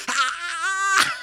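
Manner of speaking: high, loud excited ah; excited cry. Retold or imitated. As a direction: burst out with joy. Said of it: "and he goes".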